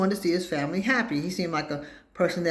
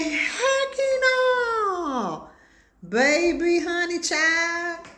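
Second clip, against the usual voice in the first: high, loud disbelieving cry; astonished exclamation; 3 dB louder, much slower than usual. Real, not acted. Teller reacting to the card fight, high astonished cry. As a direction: exclaim in shocked disbelief, drawn out.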